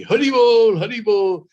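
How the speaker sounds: happy Brahmin shouting his thanks; joyful Hari bol. Acted. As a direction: cry it out with delight. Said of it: "That Brahmin became very happy".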